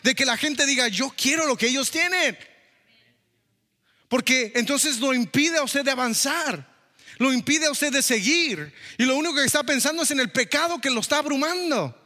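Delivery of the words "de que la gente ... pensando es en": preaching. Acted. Preaching build. (predicando despacio y un poco más agudo)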